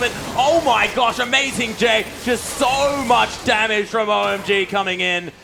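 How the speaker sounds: loud, high game-winning call; excited shout. Real, not acted. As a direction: scream the finish like a final whistle. Game-ending teamfight, Nexus falls.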